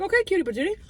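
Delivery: high-pitched cooing re-enactment; retold cutesy endearment. Customer answering the cute restaurant man, high cutesy cooing. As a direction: re-enact babying an adorable person. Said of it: "I was like".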